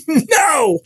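put-on high, loud childish "No!"; a boy's frightened refusal; retold scream. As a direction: scream it back as a scared boy. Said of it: "I screamed real loud".

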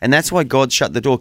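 usual speaking voice

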